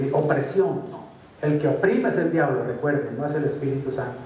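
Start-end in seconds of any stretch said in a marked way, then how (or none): none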